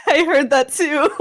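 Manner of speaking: bursting out laughing; words through real laughter. Real, not acted. Players cracking up at joke.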